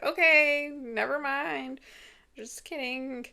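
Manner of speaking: high, sing-song retreat; mock backing off. Jordan backs off after scolding.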